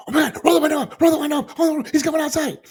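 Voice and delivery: high, loud mock panic from the back seat; panicked relatives. Relatives in the car panicking as the door opens, high mock panic. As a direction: squeal it like scared family in a car.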